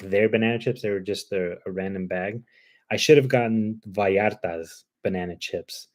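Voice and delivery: ordinary delivery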